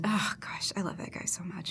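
breathless little whisper of adoration. Real, not acted. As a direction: whisper it, utterly smitten. Fond gushing aside.

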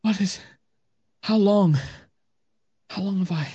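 high, halting and dazed; stunned. Acted. Freed woman asking the party how long, stunned and high. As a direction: grope for words, high and lost. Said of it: "She just seems to be stunned".

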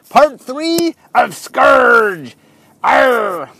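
a hint of a slow, hammed-up pirate. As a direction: growl it out like a pirate. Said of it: "I wanna talk like a pirate".

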